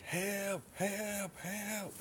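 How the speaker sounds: high-pitched but soft mock yell; retold scream for help. Boy in the water calling for help, mock high yell. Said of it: "screaming on top of my lungs".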